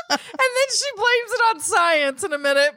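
usual speaking voice

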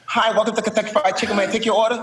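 high fast lisping drive-thru greeting; squeaky Mike Tyson. Retold or imitated. Tyson working drive-thru greets customer, high squeaky voice. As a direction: squeak it like Tyson taking orders.

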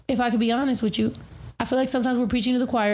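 fast, pressing the point; rushed repeat. Real, not acted. Telling friends her view, fast and pressing. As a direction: rattle it off quickly.

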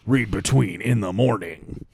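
slow, drawn-out radio DJ; shock jock radio voice. Pretend. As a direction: stretch it like a morning DJ. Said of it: "my stupid shock jock radio voice".

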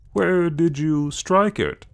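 put-on loud, high, drawn-out American accent. A narrator's reading. Evans interrupts Clayton's ghost story, in showy American accent. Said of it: "in his best American accent".

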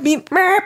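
high squeaky meep; squeaky beep. Pretend. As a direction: squeak it out like a toy horn.

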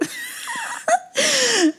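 high, strained laugh breaking into crying; laugh turning to sobs. Real, not acted. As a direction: laugh through tears. Laughing while near tears.

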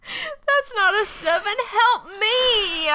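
high, laugh-choked words; real helpless laughter. Real, not acted. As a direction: crack up mid-sentence.

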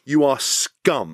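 you are scum, very slow, each word weighted; slow condemnation. Real, not acted. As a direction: spell out the verdict slowly. Slow damning verdict.

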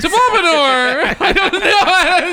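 laughing through the word. Real, not acted. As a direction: crack up while reading the misspelling. Rereading the misspelling, cracking up.

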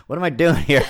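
usual speaking voice

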